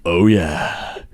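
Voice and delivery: loud, slow, drawn-out celebrity impression; Patrick Warburton. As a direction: stretch the words out in a big put-on voice. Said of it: "What if they had Patrick Warburton".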